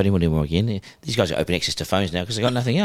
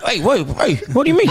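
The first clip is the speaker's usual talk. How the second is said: much higher and louder, mock alarm; alarmed protest; 13 semitones higher, 4 dB louder than usual. Inmate reacting to a joke about his wife, mock alarmed. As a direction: play the husband suddenly on guard. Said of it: "And he's like".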